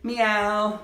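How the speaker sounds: playful mock meow for the kids; cat meow. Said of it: "can you be a cat".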